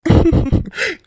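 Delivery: high teasing chuckle; short, high-pitched amused laugh. Acted. Teasing suitor laughs at her warning.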